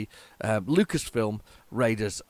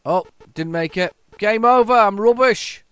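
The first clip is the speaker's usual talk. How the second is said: loud, higher cry of defeat; frustrated outburst; 9 semitones higher, 10 dB louder than usual. Real, not acted. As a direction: shout it out as you lose. Loses the game, cries out.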